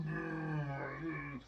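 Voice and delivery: imitated vomiting noise; mock retching. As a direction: retch out a long "brr". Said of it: "vomit anyway".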